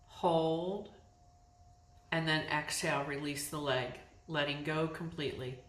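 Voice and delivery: calm, unhurried meditation voice with long pauses; guided yoga nidra. Real, not acted. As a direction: guide them into letting go, phrase by phrase. Leads yoga nidra practice.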